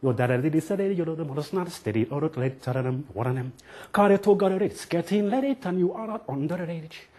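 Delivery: lyrics slurred with a rolled tongue; playful, high, tongue-rolled chant; tongue-rolling lyric. Shown as a demonstration. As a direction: garble the lyric with your tongue out. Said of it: "repeat the lyrics while rolling your tongue".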